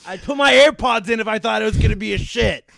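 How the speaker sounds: loud, high, exasperated protest; yelled self-defence. Retold or imitated. Defending himself to coworkers, shouted in exasperation. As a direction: belt it out indignantly.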